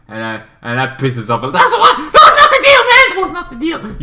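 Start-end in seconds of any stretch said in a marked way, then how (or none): 1.4-4.0 high, laughing yell; hammed-up laughing outrage; mock outrage. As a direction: squeal it out indignant and amused.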